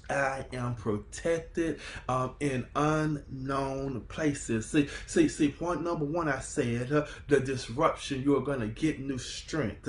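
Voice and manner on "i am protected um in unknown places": slow, drawn-out, hushed declaration; slow affirmation. Shown as a demonstration. Preacher models the words for the congregation, slow and hushed. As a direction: let each word settle slowly. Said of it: "just lay hands on yourself, say".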